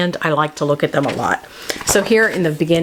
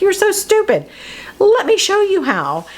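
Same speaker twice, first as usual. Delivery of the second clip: much higher, mocking witch voice; 13 semitones higher than usual. The witch scorning Gretel in a much higher voice. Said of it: "And the witch is like".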